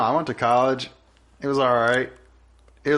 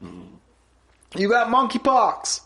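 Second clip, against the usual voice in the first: high, very drawn-out holler; mock quarterback call; 10 semitones higher, much slower than usual. Quarterback shouting diagnoses at the defense, mock drawn-out call. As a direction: stretch it out like a snap call. Imitating quarterback's outrageous calls.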